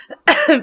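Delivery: sudden dry cough; coughing mid-sentence. Real, not acted. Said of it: "pardon me".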